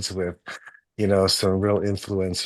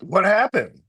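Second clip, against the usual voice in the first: much higher, bewildered inner voice; athlete's puzzled thoughts; 8 semitones higher than usual. Retold or imitated. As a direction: play the confused rider wondering what's wrong. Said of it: "And I'm thinking, like".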